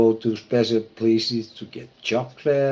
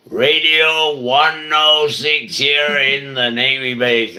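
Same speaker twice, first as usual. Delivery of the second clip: loud, high station-ID announcer voice; radio DJ voice; 6 semitones higher, 10 dB louder than usual. Retold or imitated. Radio star announcing his station on the Navy base, loud announcer voice. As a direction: belt out the call sign like a radio star. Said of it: "he talked always like this".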